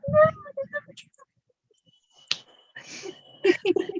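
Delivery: high teasing no no no; playful denial. Retold or imitated. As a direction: deny it like a mock-serious grandpa. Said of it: "he's like".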